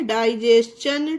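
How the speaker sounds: slow डाइजेशन with T as "ch"; ch sound for T. Shown as a demonstration. Teacher repeating for the rule, slow डाइजेशन with "ch". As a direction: say डाइजेशन slowly, ending "jes-chun". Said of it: "चा से pronounce करा गया है".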